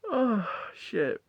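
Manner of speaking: ordinary talk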